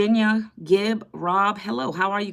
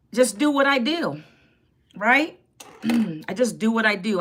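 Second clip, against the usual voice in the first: ordinary delivery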